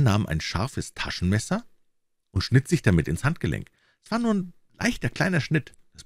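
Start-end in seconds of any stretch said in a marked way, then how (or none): none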